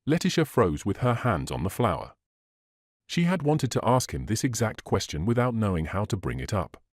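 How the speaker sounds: ordinary delivery, nothing marked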